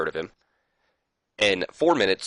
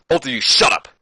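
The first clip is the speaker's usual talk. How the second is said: acted-out angry order, louder and higher; mock angry outburst; 4 semitones higher, 6 dB louder than usual. Saturn silences both men laughing at him, mock angry outburst. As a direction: put some force into the retold telling-off. Acted wrestler telling rivals off.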